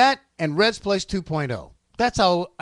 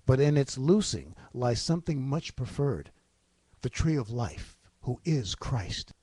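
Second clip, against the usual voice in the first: audiobook narration; 2 semitones lower, 5 dB quieter than usual. Acted. Reading a book audition about the tree of life, measured narration. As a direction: read it gravely, pausing between phrases.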